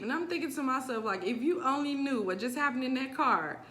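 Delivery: ordinary talk, nothing marked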